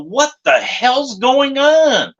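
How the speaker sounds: high, drawn-out incredulous question; baffled outburst. Retold or imitated. Sheik reacting to the mess in the ring, high drawn-out bafflement. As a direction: stretch it out in mock disbelief.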